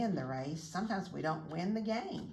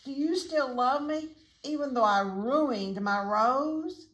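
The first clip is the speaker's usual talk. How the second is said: higher, louder chick plea; cheeping chick; 7 semitones higher, 5 dB louder than usual. A narrator's reading. Chick asks mommy for love after ruining his rose, high pleading cheep. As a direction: plead like an upset little chick. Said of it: "he cheeped".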